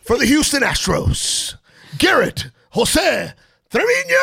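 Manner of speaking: loud, high stadium announcer call; baseball announcer. Acted. Dad testing his son's name as a batter, booming announcer. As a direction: announce him to the crowd. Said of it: "announcer's voice".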